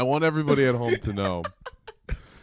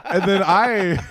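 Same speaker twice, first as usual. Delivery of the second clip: ordinary talk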